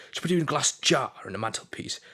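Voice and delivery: high, rising taunt in a put-on film voice; Daniel's goading. Acting out Daniel's questions to Eli, goading. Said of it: "going that and just goading one".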